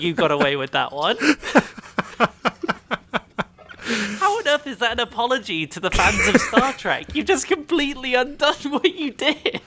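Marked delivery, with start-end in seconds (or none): 1.2-7.1 laughing in disbelief; half-laughing incredulous question. Real, not acted. 7.1-9.6 high laughing tease. Real, not acted. Teasing, laughing.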